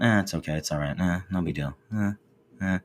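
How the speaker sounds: flat, unenthused shrug of a reply; bored meh. Retold or imitated. Someone asked how they're doing, answering with a bored meh. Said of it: "you hear in that tone".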